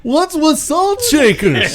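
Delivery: high, slow stand-up impression; Seinfeld. Joking about following a stand-up star, comedian impression. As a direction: mimic a comedian's puzzled observational bit. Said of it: "with Seinfeld on there".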